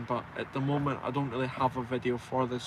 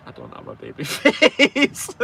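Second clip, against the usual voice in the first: laughing; 9 dB louder than usual. Real, not acted. Denying baby face while laughing.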